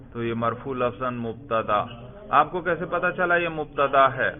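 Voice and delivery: ordinary delivery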